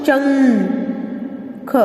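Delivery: शंख with a long nasal hum; very slow शंख, hummed through the nose; nasal anusvar demo. Shown as a demonstration. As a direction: stretch the nasal in शंख until it fades. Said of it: "इसका जो उच्चारण है वो नासिका से होता है".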